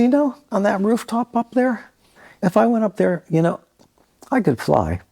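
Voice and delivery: retold boast, pitched high, easing down at the end; imitating his own high-pitched eager claim; excited boast. Musician boasting to friends he could fly, excited.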